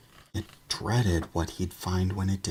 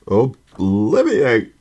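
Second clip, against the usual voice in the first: slow, loud, high spell cry; yelled spell; 7 semitones higher, 12 dB louder, much slower than usual. A narrator's reading. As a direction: bellow the spell slowly.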